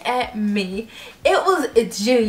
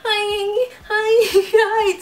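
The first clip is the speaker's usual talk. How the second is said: high, loud, panicked repeated hi; flustered high squeal; 11 semitones higher, 5 dB louder, much slower than usual. Retold or imitated. Starstruck fan blurting greetings at everyone, squealing. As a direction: squeal it, mind gone blank. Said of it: "my mind went blank".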